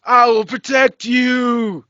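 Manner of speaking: high, very slow devoted servant voice; servant character voice. Retold or imitated. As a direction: loyal henchman pledging, drawn out. Fan imitates a loyal servant character.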